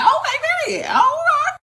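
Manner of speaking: shouted very high, laughing; delighted shout. Real, not acted. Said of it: "And I was like".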